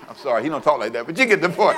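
ordinary delivery

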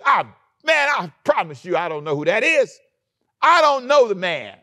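high yelled protest, frantic and pleading; panicked denial. Acted. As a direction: shout it like a cornered man.

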